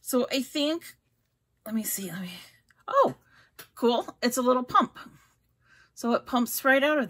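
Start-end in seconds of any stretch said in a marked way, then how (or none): 1.6-2.3 whispering. Real, not acted. Peering at the tube. 2.9-3.2 surprised. Real, not acted. Pump surprises her.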